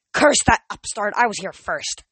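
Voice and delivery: loud, indignant outburst; angry old man. Acted. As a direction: curse the rival indignantly. Nereus rants.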